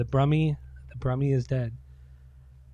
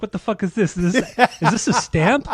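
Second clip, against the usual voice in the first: higher, louder laughing mock confusion; 7 semitones higher, 5 dB louder than usual. [name] thinking the tablet is acid, baffled and laughing. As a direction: sputter it, half laughing.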